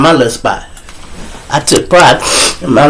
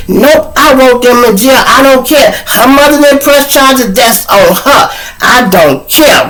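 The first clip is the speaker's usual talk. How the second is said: loud, high, indignant retort; adamant refusal; 10 semitones higher, 4 dB louder than usual. Acted. Mother insisting on pressing charges, adamant and indignant. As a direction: refuse to budge, voice pitched up and loud.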